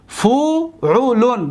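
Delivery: فعلون very slow, long separate syllables. Shown as a demonstration. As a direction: sound out فعلون as fu, u, lun. Teacher models pattern by syllables.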